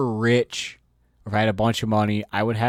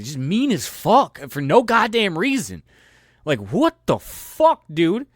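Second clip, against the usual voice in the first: ranting; 7 semitones higher, 5 dB louder than usual. Real, not acted. Ranting about mean girls.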